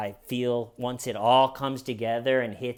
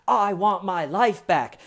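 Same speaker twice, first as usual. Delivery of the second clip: higher, hammed-up fake accent; [name]'s ridiculous accent; 5 semitones higher, 4 dB louder than usual. Suspect complains to interviewers, ridiculous put-on accent. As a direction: plead in a mocking, overdone accent. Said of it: "ridiculous accent, and one of the things he says".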